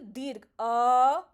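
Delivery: stretched a demo; long a vowel. Shown as a demonstration. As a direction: hold the अ long to time it. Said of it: "बोलने में मुझे कितना लगा".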